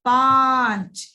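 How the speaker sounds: पांच stretched and called out, nasal vowel clear; loud slow model. Shown as a demonstration. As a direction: call out पांच slowly to show the nasal sound. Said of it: "आपको अन की ध्वनि सुनाई दी".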